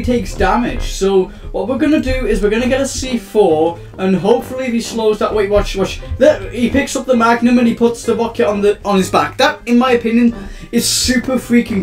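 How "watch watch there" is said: ordinary delivery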